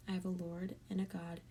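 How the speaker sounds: usual speaking voice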